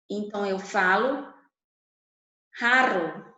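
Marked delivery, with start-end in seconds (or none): 2.6-3.3 "raro" with a rolled, vibrating r; trilled Spanish R. Shown as a demonstration. Teacher showing the class the Spanish trilled r. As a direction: roll the r in "raro" hard. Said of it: "Eu vibro a minha fala".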